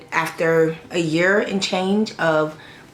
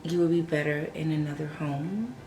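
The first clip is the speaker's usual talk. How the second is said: soft, quiet farewell, holding back tears; whisper-soft goodbye to the puppy; tender goodbye; 9 dB quieter than usual. Real, not acted. Owner saying a tearful farewell to her dog Fendi, soft and quiet.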